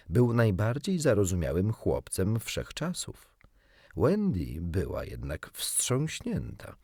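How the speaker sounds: ordinary delivery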